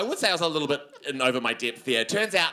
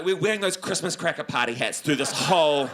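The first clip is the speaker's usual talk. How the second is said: ordinary talk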